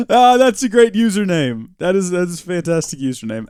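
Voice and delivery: high, loud delight after a laugh; delighted praise. Real, not acted. Streamer praising a viewer's funny username, loud delight. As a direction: gush it loudly, still tickled.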